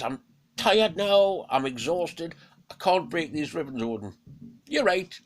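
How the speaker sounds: a beaten beast asking to go free; exhausted wolf. Acted. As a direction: Fenrir pleads with Odin, high and loud. Fenrir worn out.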